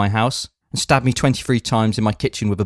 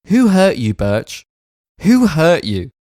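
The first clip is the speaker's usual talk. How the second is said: dramatic plea; 3 semitones higher, slower than usual. Acted. Letter writer addresses Birch dramatically.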